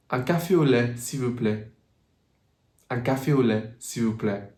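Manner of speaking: UN CAFÉ AU LAIT, S'IL VOUS PLAÎT with pure vowels, falling; French order with LAIT and PLAÎT ending EH; French pronunciation. Shown as a demonstration. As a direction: model the phrase in French, softening at the end. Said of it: "you can say".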